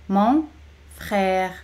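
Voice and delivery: "Mon", clear pause, "frère"; teacher's spaced-out "Mon ... frère"; word by word. Shown as a demonstration. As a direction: separate "Mon" from "frère" for learners. Teacher separates the words for repetition.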